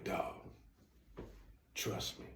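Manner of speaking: near-whispered, confiding persuasion; very soft, with a weighty pause; hushed assurance. Acted. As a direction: act out a friend quietly vouching for it. Homie confidingly persuading him to try it.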